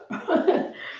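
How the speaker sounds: short airy laugh, high and unvoiced; breathy laugh. Real, not acted. Laughing at her own panic at the checkout, airy laugh. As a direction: laugh it off under your breath.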